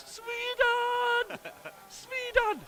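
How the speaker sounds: high cheering chant of Sweden; excited cheer. Pretend. Reading chat message as cheer.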